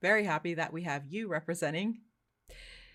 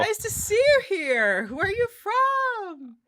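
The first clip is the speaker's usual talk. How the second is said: high, loud and slow, hammed-up friendly; overeager American small talk; 14 semitones higher, 9 dB louder, slower than usual. Mocking Americans meeting abroad, slow loud gushing chatter. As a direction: stretch each word like a delighted tourist. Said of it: "if you see other Americans in Europe".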